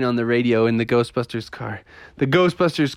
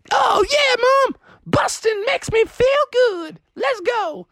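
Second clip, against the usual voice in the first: put-on high, squealing kid excitement; excited kids. Kids in Ghostbuster suits answer mom, excited. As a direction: cheer it in a high child voice. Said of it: "And they were like".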